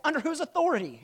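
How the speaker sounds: high and loud, a challenged lord's indignation; indignant demand. Retold or imitated. Lord demanding to know the king's authority, loud indignant challenge.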